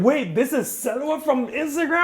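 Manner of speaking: high, loud, drawn-out screaming fan; fangirl squeal. Retold or imitated. Fan meeting the Instagram star, squealing with excitement. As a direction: shriek it with giddy delight. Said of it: "they were like".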